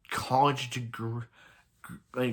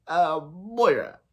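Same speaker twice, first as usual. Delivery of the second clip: voicing Oliver; 5 semitones higher, 4 dB louder, slower than usual. Voicing Oliver in retold scene.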